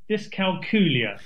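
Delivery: dyscalculia with stress on "cal", "dis-kal-KYOO-lee-a", rhymes with Julia; correct pronunciation. Shown as a demonstration. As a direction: demonstrate dyscalculia clearly, ending like Julia. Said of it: "How do we pronounce it?".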